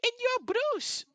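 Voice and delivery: very high, loud and slow; excited owl. Acted. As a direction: drag the name out like a thrilled little owl. Owl greets party.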